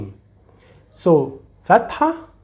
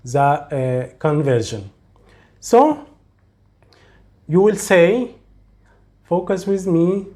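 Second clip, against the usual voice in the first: ordinary talk, nothing marked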